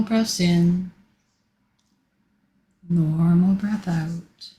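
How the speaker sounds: slow, drawn-out breathing cues; long stretched words, unhurried pauses between; guided breathing. Real, not acted. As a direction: lead a group breath slowly. Leads guided breathing exercise.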